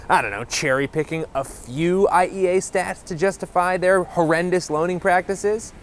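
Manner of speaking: ordinary talk, nothing marked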